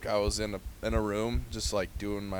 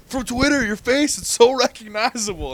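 gushing fan; 9 semitones higher, 5 dB louder than usual. Retold or imitated. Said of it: "And she goes".

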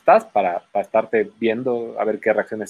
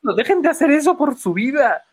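high, laughing plea; mock desperate plea; 12 semitones higher than usual. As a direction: beg them to stop, hammed-up. (súplica exagerada y aguda)

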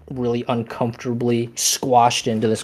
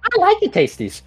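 very high squeaky bickering head; two bickering giant voices; 3 dB louder than usual. Acted. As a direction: squeak it out, insisting like a greedy monster. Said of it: "they're bickering back and forth".

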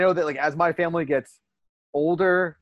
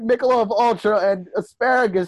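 higher, louder anguished wail; loud anguished cry; 7 semitones higher, 6 dB louder than usual. Retold or imitated. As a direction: cry it out like it burns. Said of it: "you're like".

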